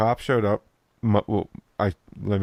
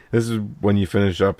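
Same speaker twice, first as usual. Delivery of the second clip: ordinary talk, nothing marked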